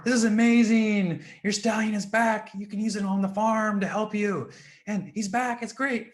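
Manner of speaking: high, loud, gushing praise; excited villagers. Acted. Villagers gush to the farmer about his stallion, excited and loud. Villagers celebrate the horse's return.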